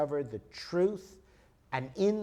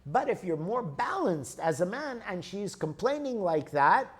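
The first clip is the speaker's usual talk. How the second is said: ordinary delivery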